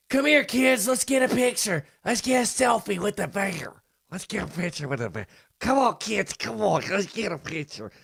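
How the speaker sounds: high-pitched, eager tourist voice; mock clueless dad. Host acts a tourist calling kids over to a bear, mock eager. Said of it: "the guy that walks up on a bear and goes".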